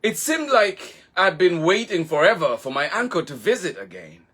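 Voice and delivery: put-on children's librarian reading to little listeners, gently; storytime narration. A narrator's reading. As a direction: read it like a bedtime picture book. Reads picture book aloud.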